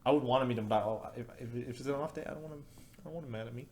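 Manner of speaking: ordinary talk, nothing marked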